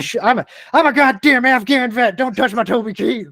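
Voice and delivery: high, loud put-on voice of a touchy veteran. Afghan vet warns friend off his CD, high mocking voice. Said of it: "It's like [name] CD".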